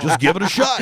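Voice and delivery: high, perky fake urging; mock encouragement. Mocking what they tell new comics, mock encouragement. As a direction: cheer them on in jest.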